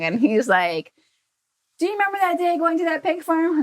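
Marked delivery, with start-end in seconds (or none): 1.8-3.6 quick, higher teasing dad voice; imitating dad, higher. Dad reminding his daughter of the pig farm, quick and higher. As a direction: play dad nudging his kid, a notch higher and fast.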